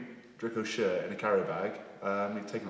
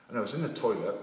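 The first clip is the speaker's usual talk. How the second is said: ordinary talk, nothing marked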